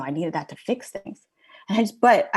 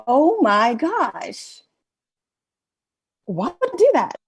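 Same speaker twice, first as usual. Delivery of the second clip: laughing realization; 8 semitones higher, 6 dB louder, slower than usual. Retold or imitated. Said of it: "I started laughing".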